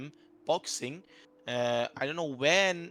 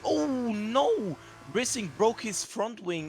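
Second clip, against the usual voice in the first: shocked outburst; 8 semitones higher, slower than usual. Real, not acted. Sudden crash during race commentary.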